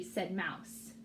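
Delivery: usual speaking voice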